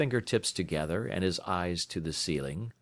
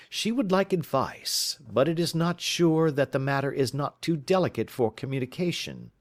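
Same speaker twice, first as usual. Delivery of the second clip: a touch higher, assured and musing; a lightly played sleuth holding forth; lightly voiced detective; 7 semitones higher than usual. Detective lecturing his friend on crime, lightly voiced and assured. Holmes reads the visitor's symptoms.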